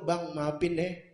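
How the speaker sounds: soft, slow, sheepish apology; embarrassed. Retold or imitated. Girlfriend apologizing to her date after a fart, soft and sheepish. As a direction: mumble it softly, ashamed. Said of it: "Mukanya malu".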